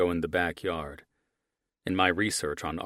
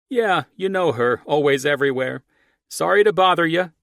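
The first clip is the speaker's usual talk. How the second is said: much higher, louder friendly call; neighbor calling back; 10 semitones higher, 11 dB louder than usual. Acted. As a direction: apologize cheerfully across the yard. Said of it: "Archie replied".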